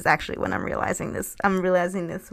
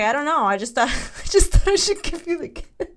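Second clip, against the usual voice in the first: ex's voice pitched up high and louder; playing the clueless ex, pitched way up; high mock ex-boyfriend voice; 8 semitones higher, 6 dB louder than usual. Retelling her dream, mocking her ex in a high voice. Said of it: "He's like".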